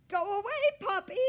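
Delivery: very high, loud squeaking shout; frantic squeak. A narrator's reading. Hamster shrieking at the puppy to leave. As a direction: shriek it at the dog. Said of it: "I squeaked".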